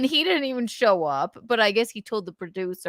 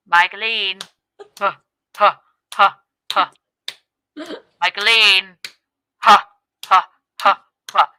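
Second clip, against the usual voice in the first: mocking impression; 8 dB louder than usual. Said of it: "she's gonna go".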